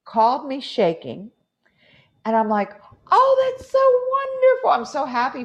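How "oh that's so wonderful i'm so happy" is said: high, loud burst of joy; gushing delight. Retold or imitated. Teacher retelling her thrilled reply to a scared student, gushing. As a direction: gush with exaggerated happiness for them.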